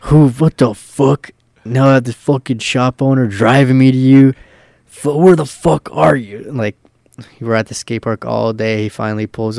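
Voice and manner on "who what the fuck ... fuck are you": retold pissed-off rant. Driver pulling up to stranded skate crew, pissed off. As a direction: imitate a fuming driver cursing at his friends. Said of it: "so pissed".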